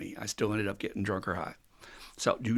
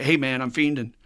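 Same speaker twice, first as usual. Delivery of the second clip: urgent call for help; 4 semitones higher, 7 dB louder than usual. Retold or imitated. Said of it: "call my sponsor and say".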